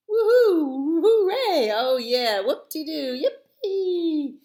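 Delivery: high, loud whoops of joy; cheering. Acted. Party animals cheering for the bear, loud and high. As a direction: celebrate at the top of your voice.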